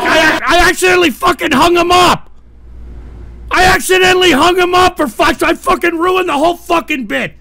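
loud, high-pitched frustrated swearing; cursing yourself, high and loud; upset outburst. Real, not acted. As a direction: yell in dismay at your own blunder. Host upset at hanging up caller.

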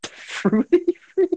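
incredulous. Real, not acted. Incredulous repeat.

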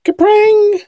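imitated part pinging away; cartoon twang, leaping very high and loud; loud, drawn-out high-pitched boing; high ringing "kapring". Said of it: "it went kapring!".

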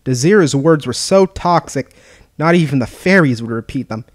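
ordinary delivery, nothing marked

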